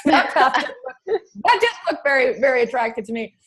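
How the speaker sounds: high, amused voice through laughter; laughing. Real, not acted. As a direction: talk through your own laugh. Laughing at her own grandmother remark.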